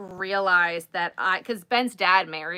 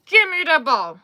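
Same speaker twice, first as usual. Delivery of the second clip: louder command; 6 semitones higher, 9 dB louder than usual. Retold or imitated. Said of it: "I'm like".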